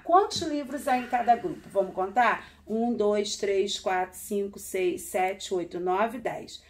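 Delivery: ordinary talk, nothing marked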